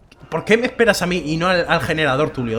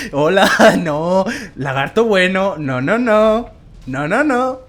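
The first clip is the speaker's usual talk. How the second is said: coaxing; 4 semitones higher, 5 dB louder, much slower than usual. Real, not acted. Coaxing the dragon away.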